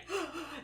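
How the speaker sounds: short, sharp mock gasp of shock; acted startled intake of breath. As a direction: imitate everyone gasping in shock. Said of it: "them being all shocked".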